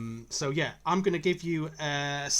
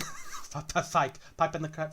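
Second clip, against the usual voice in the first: put-on Dutch accent. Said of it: "say it like a Dutchman".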